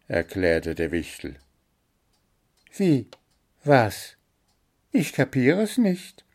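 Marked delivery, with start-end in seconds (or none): 2.7-6.2 high, baffled little bird; flustered squeaks of disbelief; the bird is stunned by the gnome's odd behaviour, bewildered; dumbfounded. A narrator's reading. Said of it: "entgegnete Zwitschi entgeistert".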